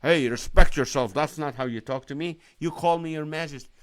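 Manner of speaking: a lightly voiced pompous king, the first word blurted loud; a hint of an offended monarch scolding; mock haughty God. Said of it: "He's not gonna get upset and like".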